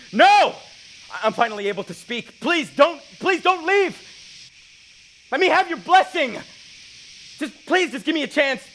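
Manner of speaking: high, loud, frantic begging; desperate pleading. Acted. Son begging his departing ancestors for their blessing, desperate. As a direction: plead as they walk away.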